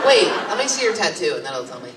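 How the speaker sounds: ordinary delivery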